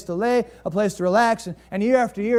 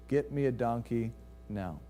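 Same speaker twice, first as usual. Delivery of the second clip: calm command; 6 semitones lower, 8 dB quieter than usual. Retold or imitated. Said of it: "instead of yelling at her husband".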